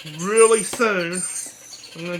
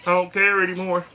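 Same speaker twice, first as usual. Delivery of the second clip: ordinary delivery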